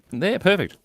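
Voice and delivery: normal talking voice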